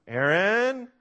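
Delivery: name called high and loud, rising at the end; mom calling warning. Retold or imitated. Mother calling her son who is in trouble, high rising call. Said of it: "with her tone going up at the end of my name".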